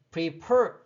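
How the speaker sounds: prefer stressed on FER; light pre, then a strong FER in prefer; stress on last syllable. Shown as a demonstration. Teacher reading an example aloud, pre-FER. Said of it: "뒤에 이의 강세가 있어요".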